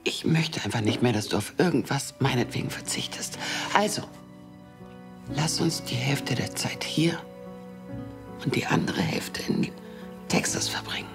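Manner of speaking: soft intimate whisper; tender whisper. Acted. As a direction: breathe it gently to your love. Tender reconciliation between lovers. (zärtliches Flüstern)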